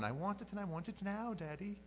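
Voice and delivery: high-pitched imitation of a demanding child; annoying kid. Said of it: "the annoying one".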